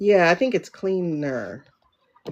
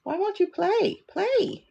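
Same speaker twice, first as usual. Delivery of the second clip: frustrated; 14 semitones higher, slower than usual. Real, not acted. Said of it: "video won't play".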